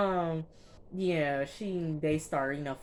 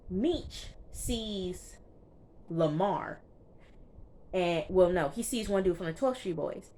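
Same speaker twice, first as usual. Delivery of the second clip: normal talking voice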